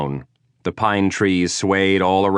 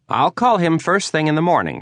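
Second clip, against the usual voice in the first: higher, lightly voiced woman client; woman's voice; 11 semitones higher, 3 dB louder than usual. Client promising the detective to call, higher woman's voice. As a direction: lift into a polite older woman.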